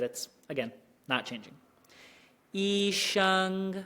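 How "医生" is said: "医生" very slow, level high pitch; practicing tones. Shown as a demonstration. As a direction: hold "医生" flat and steady. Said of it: "Same from E into sheng".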